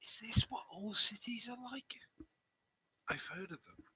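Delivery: hushed, whispery aside in character; confiding half-whisper; leaning in close. Acted. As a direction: lean in and ask it under your breath. I'm gonna lean in close.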